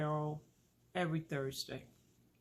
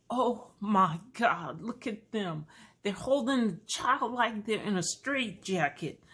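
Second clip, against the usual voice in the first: shocked judgment; 3 semitones higher, 6 dB louder than usual. Retold or imitated. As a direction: gasp at them, loud and scandalized. Judging other parents in her head.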